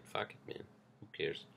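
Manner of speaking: normal talking voice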